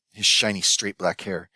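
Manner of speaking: ordinary delivery, nothing marked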